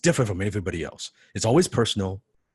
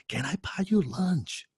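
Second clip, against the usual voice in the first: eager question; 11 semitones higher, 5 dB quieter, slower than usual. Retold or imitated. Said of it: "That one question changed my life".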